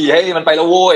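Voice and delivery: high gleeful party cheer; mock cheer. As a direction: cheer like coworkers glad he's gone. (เพื่อนร่วมงานโห่ดีใจที่เขาออกไป เสียงสูงร่าเริง)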